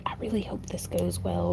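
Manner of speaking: soft unvoiced hope; whisper. Real, not acted. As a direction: whisper it to yourself. Hopeful aside while weaving.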